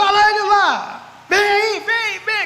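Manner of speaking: high, loud shouts across the street; calling out. Retold or imitated. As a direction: hail him over from the party. Friends call him to the party.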